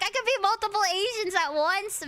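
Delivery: high, exasperated retort; indignant protest. Retold or imitated. Streamer arguing she can be several Asians, indignant protest. Retold protest to the same claim.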